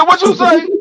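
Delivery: high, indignant challenge. Retold or imitated. Grandpa daring grandson to repeat the insult, high indignant. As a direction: dare him to say it again.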